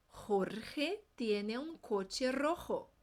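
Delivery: very slow, word by word; "Jorge" and "rojo" with throat J; very slow drill. Shown as a demonstration. Teacher modeling slowly. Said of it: "vamos repetir".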